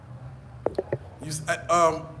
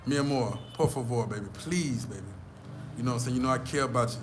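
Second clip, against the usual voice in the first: low, soft sweet-talk to a lover; suave pleading; 3 semitones lower, 3 dB quieter than usual. Shown as a demonstration. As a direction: plead smoothly, as a smooth-talking lover. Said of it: "You got to be like this here".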